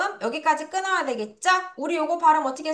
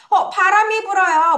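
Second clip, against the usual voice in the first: higher, louder mock exclamation; acted surprise at wind; 7 semitones higher, 5 dB louder than usual. Teacher cues learners to say it's windy, acted surprise. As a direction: exclaim as if just noticing the wind.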